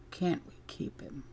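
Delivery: scornful whisper. Retold or imitated. Echoed back in scorn.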